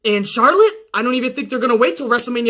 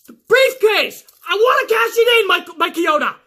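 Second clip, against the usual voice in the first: high, shouted exasperation; exasperated Carmella; 8 semitones higher than usual. Retold or imitated. Carmella yells at the referee, high and exasperated. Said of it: "he's like".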